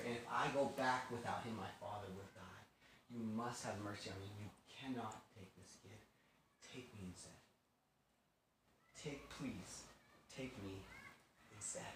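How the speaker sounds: low, very soft entreaty; subdued, self-offering plea; hushed pleading. Retold or imitated. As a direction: beg quietly and gravely. Judah begs to replace his brother.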